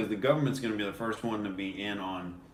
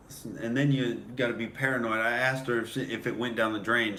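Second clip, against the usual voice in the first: usual speaking voice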